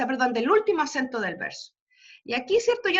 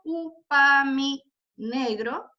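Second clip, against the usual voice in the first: "upa, mi, negro" slowly split up, weight on U and NE; accent pattern; much slower than usual. Shown as a demonstration. Teacher showing the accent scheme, slow stressed "upa, mi, negro". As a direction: draw out "upa, mi, negro" to show its beats. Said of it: "Es el mismo esquema acentual".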